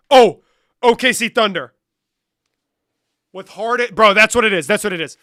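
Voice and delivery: loud, high burst of recognition; thrilled to get it right; excited realization. Real, not acted. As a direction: blurt out the answer you just remembered. Finally recalls the answer.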